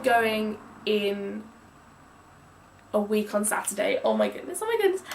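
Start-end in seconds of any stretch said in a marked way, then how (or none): 4.1-5.0 gushing, giddy exclamation; bursting with excitement about the trip; excited. Real, not acted. About going to Austria within the week: giddy excitement. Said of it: "I'm so excited".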